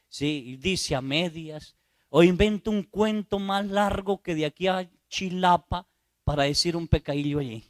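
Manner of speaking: ordinary talk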